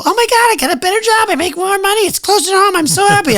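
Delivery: high, fast, overjoyed squeal; hammed-up high delight; gushing mock joy. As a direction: parody someone thrilled about a better job. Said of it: "they go".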